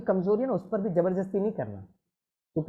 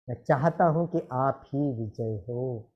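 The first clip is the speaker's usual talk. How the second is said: said lovingly; 8 semitones lower, slower than usual. Retold or imitated. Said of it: "वो खुद बहुत प्यार से कहता है".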